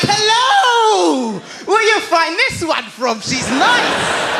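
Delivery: hammed-up admiring father; delighted dad. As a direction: crow it high, then settle. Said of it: "he's like".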